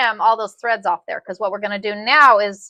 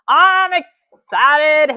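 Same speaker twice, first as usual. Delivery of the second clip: drawn out very slow, higher and louder; excited; 5 semitones higher, 5 dB louder, much slower than usual. Real, not acted. Telling the viewers she's thrilled, a slow drawn-out squeal. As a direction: stretch it out with real glee.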